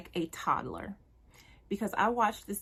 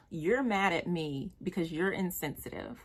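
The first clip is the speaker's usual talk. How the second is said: ordinary talk, nothing marked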